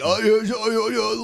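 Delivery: high, slurred mock mumble. Co-host mimicking someone nobody can understand, mock unintelligible mumble. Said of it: "you can't understand what Aussie's saying".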